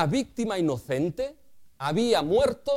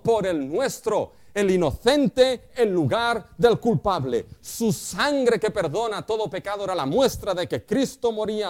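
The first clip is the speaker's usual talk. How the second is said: louder, preaching at full force; fiery preaching; 3 semitones higher, 6 dB louder than usual. Acted. Pastor proclaims to the church, loud preaching. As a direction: drive the point home to the congregation.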